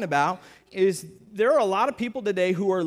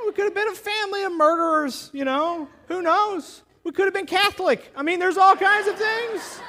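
laughing sarcasm; 11 semitones higher, 6 dB louder than usual. Real, not acted. Mock fears of the stranger, laughing.

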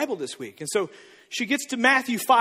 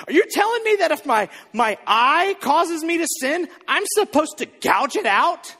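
put-on higher, louder incredulous child's questions; indignant daughter; 7 semitones higher, 4 dB louder than usual. Daughter challenging her dad over the verses, high and incredulous. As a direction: voice a kid who can't believe it.

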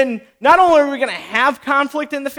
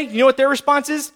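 ordinary talk, nothing marked

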